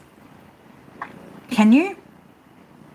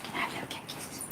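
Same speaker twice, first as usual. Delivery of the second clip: breathy whisper to the cat; 22 dB quieter, faster than usual. Real, not acted. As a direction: whisper the request for kisses.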